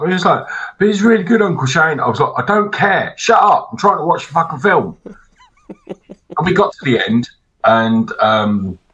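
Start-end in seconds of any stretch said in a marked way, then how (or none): 0.8-2.0 put-on high, louder pleading little boy voice; whiny kid. 2.4-5.0 exasperated snap. Retold or imitated. Snapping at nephew.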